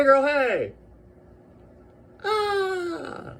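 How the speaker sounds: shrieking high and loud with delight; joyous screaming. Real, not acted. Unboxing the rank badges, screaming with joy. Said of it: "that joyous reaction was me".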